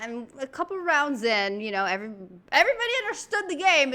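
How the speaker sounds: normal talking voice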